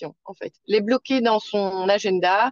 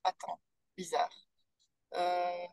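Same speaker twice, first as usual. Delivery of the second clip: faint, far-off crackling connection; crackly distant audio; 3 semitones lower, 9 dB quieter than usual. Real, not acted. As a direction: voice dropping away on a bad line. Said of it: "t'es parti plus loin".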